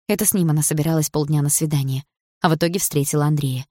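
ordinary delivery, nothing marked

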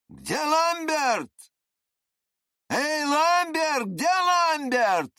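very high, long-held holler; bellowed call. A narrator's reading. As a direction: roar the name out as you walk away. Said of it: "рявкнул он последний раз".

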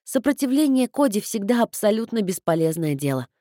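plain reading voice

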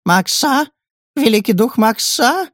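high, stunned questioning; shocked disbelief. A narrator's reading. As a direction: gasp it out in disbelief. Elder shocked, high-pitched.

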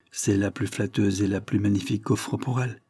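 ordinary delivery

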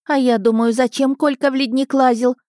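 high-pitched grumbling woman; lamenting. A narrator's reading. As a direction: carry on wailing in indignation. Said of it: "снова запричитала женщина в фартуке". (причитания возмущённой женщины высоким голосом)

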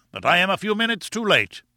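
high-pitched, self-important professor; imperious professor. Acted. Late professor addresses his waiting party, high and imperious. Challenger speaks on his sudden arrival.

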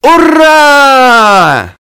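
high joyful group shout; cheering. Acted. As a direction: cheer loudly in triumph. Whole team cheers together.

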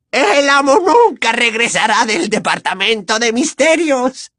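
high, gloating house-elf voice; gleeful elf. Acted. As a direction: savor the bad news like a spiteful elf. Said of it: "afirmó alegremente".

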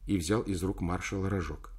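usual speaking voice

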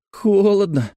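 put-on high child's whine; complaining girl. A narrator's reading. Girl in ditch water complains of cold, high whine. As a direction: complain as a small girl, pitched well up. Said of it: "пожаловалась Лекси".

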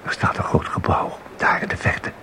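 soft near-whisper; quiet, awed description of a distant building; hushed. Acted. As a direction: whisper it as if afraid to be overheard. Visitor describing eerie marsh.

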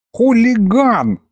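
squeaked, high and slow; squeaky voice. A narrator's reading. Left dragon head squeaks an accusation at the culprit, squeaky. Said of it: "пропищала левая".

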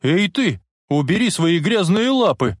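high, forceful challenge; stern threat. A narrator's reading. Timid hero challenges attacker in car, stern and high. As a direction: lift the pitch and command sternly. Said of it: "он грозно произнёс".

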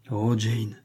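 understated anguished exclamation; a touch of aching despair; pained anguish. A narrator's reading. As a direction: cry out in restrained pain. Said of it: "avec un ton de douloureuse angoisse".

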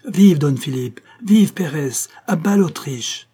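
high, loud rallying cries; crowd cries. A narrator's reading. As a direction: cry out like a cheering crowd. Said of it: "on distinguait ces cris".

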